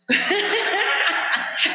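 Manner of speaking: short high burst of laughter; a real laugh at the mix-up; quick amused laugh before correcting herself. Real, not acted. Laughs at wrong name in program.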